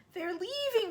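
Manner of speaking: put-on high, breathless distressed child; a girl blurting bad news; girl crying out. A narrator's reading. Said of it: "she cried".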